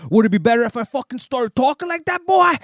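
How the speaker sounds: very high, very loud put-on voice; shouted comeback. Turning on insulting driver, hammed-up shouted comeback. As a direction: holler it back, hammed up. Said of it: "turned around and said".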